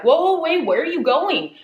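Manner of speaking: high, loud startled call after a friend; alarmed shout. Retold or imitated. Mike calling after Eddie as he marches out, alarmed. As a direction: stop him in alarm, voice jumping up.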